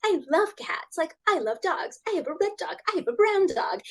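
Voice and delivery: high eager chatter; childlike pet babble; excited chatter. Retold or imitated. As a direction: authors gushing about their pets. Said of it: "everyone would be like".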